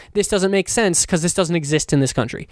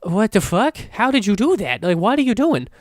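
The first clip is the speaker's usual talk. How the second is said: higher-pitched baffled questioning; incredulous; 4 semitones higher than usual. Acted. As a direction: demand an explanation in mock disbelief. Mock-questioning the game's makers.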